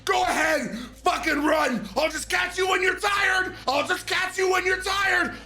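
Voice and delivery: adrenaline-fueled taunt; taunting yell. Retold or imitated. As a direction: shout after her, pumped on adrenaline. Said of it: "I go".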